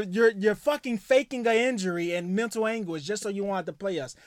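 usual speaking voice

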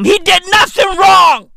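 loud, high outraged shout. Acted. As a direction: yell it in mock outrage. Comic rant defending Kurt Zuma.

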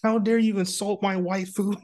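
high, indignant mock outrage; offended fan voice. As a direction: play an outraged anime fan. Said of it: "those are the kind of comments and message I would get".

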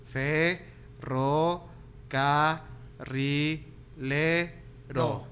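slow, one syllable at a time; split into syllables. Shown as a demonstration. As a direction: break every word into syllables. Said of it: "que les tengas que hablar así".

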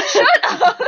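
giggling through the words; laughing. Real, not acted. Laughing after embarrassment.

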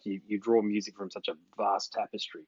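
normal talking voice

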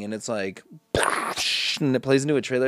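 ordinary talk

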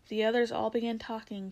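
ordinary delivery, nothing marked

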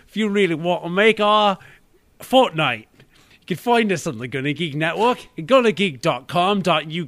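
hammed-up cockney podcast sign-off; playful fake British host voice; British accent. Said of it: "we should do a British version of this".

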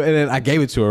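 ordinary talk

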